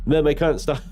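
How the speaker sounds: high mock-distressed Welsh child; Welsh accent. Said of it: "anything other than the Welsh accent".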